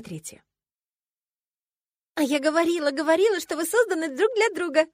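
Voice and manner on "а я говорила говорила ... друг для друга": high, loud gleeful squeal; squealing with delight. A narrator's reading. Roommate thrilled about the match, squealing. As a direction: gush excitedly, almost squeaking. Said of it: "Почти что пища от восторга".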